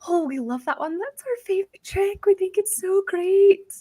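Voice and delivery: high, gleeful dolphin chatter; dolphins cheering. Acted. Dolphins praising the clumsy landing, high and gleeful. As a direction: squeal it like delighted dolphins. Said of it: "They are like".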